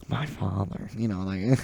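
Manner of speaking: a hushed, whispered Joker impression. Teen doing his old Joker voice, a hushed whisper. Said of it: "I can't even do the voice anymore".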